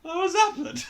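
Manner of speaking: hammed-up confused child; baffled kid voice. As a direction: squeak it out, confused.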